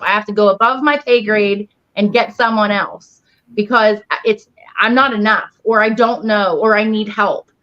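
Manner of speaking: normal talking voice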